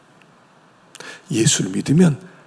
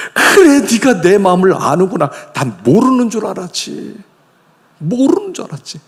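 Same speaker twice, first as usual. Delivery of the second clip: joyful father; 6 semitones higher than usual. Retold or imitated.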